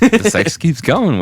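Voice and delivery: high slow catchphrase; loud punchline. Acted. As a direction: proclaim it slowly.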